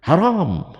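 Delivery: haram said high and loud, clipped at the end; a firm high "Haram" with a falling close; quoting himself declaring haram, loud and high. Retold or imitated. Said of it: "kecuali saya bilang".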